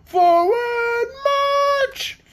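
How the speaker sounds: very high, sing-song screamed command; screamed order. Acted. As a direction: scream it at the lone private. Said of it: "captains screamed at the private".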